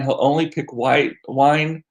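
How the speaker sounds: usual speaking voice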